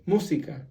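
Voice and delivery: música stressed on "mú", MÚ-si-ca; stress on first syllable. Shown as a demonstration. Teacher follows the tilde, música stressed on "mú". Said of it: "on the first syllable".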